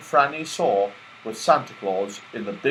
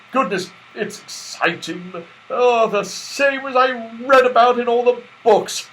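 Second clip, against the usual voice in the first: high, louder eager voice; excited Santa; 10 semitones higher, 5 dB louder than usual. A narrator's reading. Santa hesitating at the slip, eager and excited. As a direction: bubble with giddy nerves.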